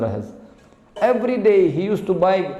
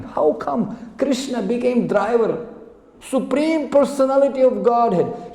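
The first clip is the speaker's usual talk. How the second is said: amazed wonder; 6 semitones higher than usual. Acted. Crying devotee marvels at Krishna serving, amazed wonder. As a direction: marvel at it, voice lifting high.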